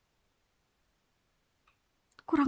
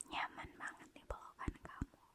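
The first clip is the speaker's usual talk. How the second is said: whisper; 17 dB quieter than usual. Acted. Whispering in his embrace.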